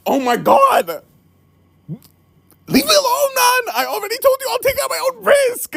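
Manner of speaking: high-pitched exasperated yelling; mock angry yell. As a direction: shout him off like he's pestering you. Delivery man yelling at Sarah.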